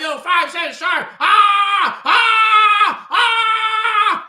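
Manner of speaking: high, loud hammed-up yelling clerk. Preacher imitating the corner store man yelling he's short, loud mock shout. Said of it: "First, he speaks Arabic and".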